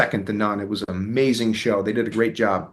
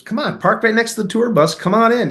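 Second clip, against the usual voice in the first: high-pitched, eager urging; lively, insistent welcome; insistent invitation; 10 semitones higher than usual. Retold or imitated. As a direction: retell a friend waving someone in, pitched up. Retold host insisting he join.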